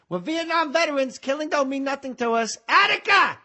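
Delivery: put-on high, edgy young Al Pacino voice; young Pacino impression building to a yell. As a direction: do early Pacino, wound up. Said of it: "'Cause young Pacino".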